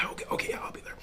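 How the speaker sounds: soft voiceless croak; voiceless whisper. Retold or imitated. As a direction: answer with no voice left. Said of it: "talking like this".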